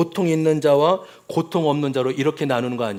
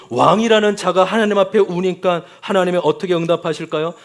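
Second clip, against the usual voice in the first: usual speaking voice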